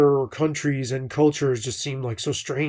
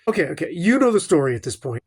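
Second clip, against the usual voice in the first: normal talking voice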